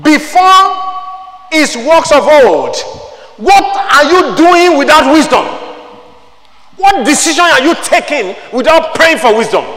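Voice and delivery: high, loud, slow preaching cry; full-force preaching. Acted. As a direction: thunder the question at the church.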